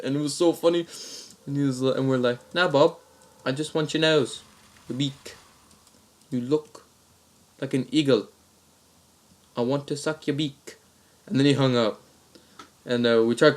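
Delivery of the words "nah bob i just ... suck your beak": put-on Aussie prank voice; mock-Australian, halting between phrases; Australian accent. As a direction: proposition as an Australian caller. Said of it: "same Australian accent".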